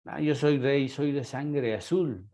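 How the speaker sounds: ordinary talk, nothing marked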